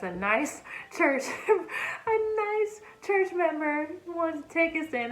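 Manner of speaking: laughing. Real, not acted. Laughing at the irony.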